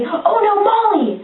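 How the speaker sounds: hammed-up cry of dread, pitched high; mock alarm. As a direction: imitate sudden alarm for a friend. Acting characters' alarm at burning house.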